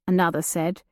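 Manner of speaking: usual speaking voice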